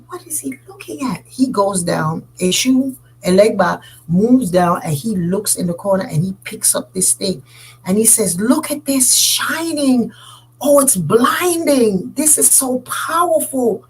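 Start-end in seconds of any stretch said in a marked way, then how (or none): 0.1-1.2 hushed unvoiced aside; a secret puzzled whisper to a friend. Villager puzzled by trickster. 8.4-13.8 slow, higher, marveling; stretched-out amazed delight; awed wonder. Acted. Elegba marvels at coconut.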